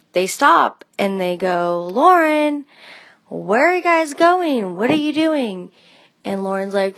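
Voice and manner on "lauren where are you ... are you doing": calling out. Retold or imitated. Parents call out from car.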